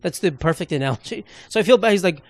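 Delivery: ordinary talk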